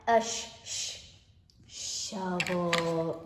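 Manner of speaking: "sh-, sh-" hissed, then "shovel"; sound-out. Shown as a demonstration. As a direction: stretch the sh before the word. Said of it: "Where do we hear that S H sound".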